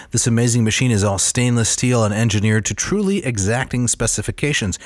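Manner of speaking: ordinary talk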